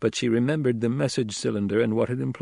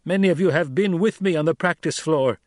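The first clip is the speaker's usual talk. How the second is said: higher, louder, commanding orator; young duke addressing tribe; 6 semitones higher, 4 dB louder than usual. A narrator's reading. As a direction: challenge the crowd with rising authority. Paul addresses the throng.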